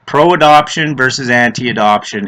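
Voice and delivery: ordinary delivery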